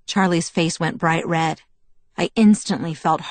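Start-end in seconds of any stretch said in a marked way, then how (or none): none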